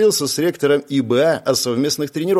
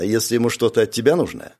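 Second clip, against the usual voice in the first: usual speaking voice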